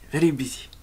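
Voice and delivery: "very busy" attempted with P for V; Korean pronunciation. Shown as a demonstration. Student repeats after teacher, Korean "very busy". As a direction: copy the Korean-style "very busy".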